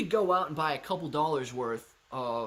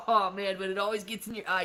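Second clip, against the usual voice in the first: loud high burst of laughter into a laughing line; laughing through the words; 4 semitones higher, 5 dB louder than usual. Real, not acted. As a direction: crack up at the bit. Salt in eye joke.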